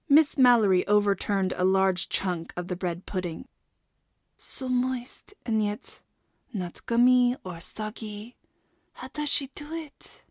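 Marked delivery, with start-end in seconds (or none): 4.4-10.2 lightly French cook mumbling in wonder; mumbled. A narrator's reading. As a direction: murmur it to yourself, rapt. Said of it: "she mumbled".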